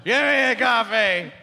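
slow mock bellow of a customer; mayor demanding coffee. Imitating a mayor ordering coffee in the forest, slow mock demand. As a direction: demand it like a hammed-up confused loudmouth.

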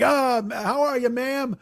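high-pitched imitation of a chummy customer; acted effusive greeting. The ace hailing the lady barkeep, effusive. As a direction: pitch it up as a mock charmer.

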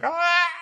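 high-pitched hammed-up scream; imitated wail between laughing and crying; mock wail. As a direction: let out a high, fake anguished cry. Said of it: "he's laughing or crying".

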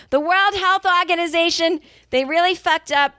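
'Organization' in a heated Boston accent, high and sharp; angry Boston accent. Real, not acted. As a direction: spit out 'World Health Organization' with a fired-up Boston accent. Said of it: "the Boston accent comes out when she's angry".

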